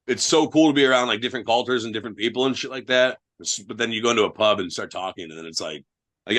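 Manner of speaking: ordinary talk, nothing marked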